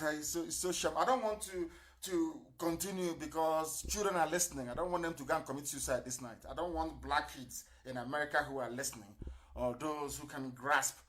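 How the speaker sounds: usual speaking voice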